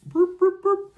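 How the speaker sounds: very high, short boops; three loud high-pitched vocal beeps; imitated beeps. Host interrupts the retelling, making high beep noises.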